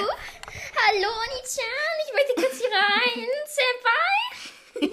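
cute anime streamer. Pretend. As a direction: squeak like a shy anime bear. Uwu bear character speaks.

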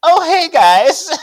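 loud, very high, drawn-out hello; mock casual greeting. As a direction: sing out an exaggeratedly breezy greeting. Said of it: "she just casually goes".

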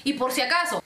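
ordinary delivery

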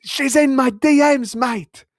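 high, loud mock Irish accent; bad Irish accent. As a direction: push out a terrible squeaky-high Irish brogue. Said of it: "I'm usually better at accents".

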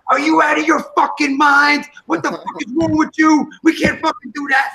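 high, heated re-enacted yell; retold yelling. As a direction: act out blowing up at a partner. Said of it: "And I'm yelling".

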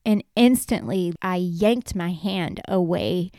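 ordinary delivery

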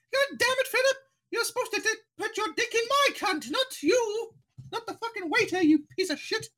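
shrieky falsetto mock monarch; the Queen. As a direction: scold a husband in a very high, hammed-up royal voice. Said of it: "she is the Queen of England".

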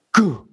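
one short popping "goo" noise; imitated speaker pop. Bassist showing the amp pop again, popping noise. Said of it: "does the same thing again".

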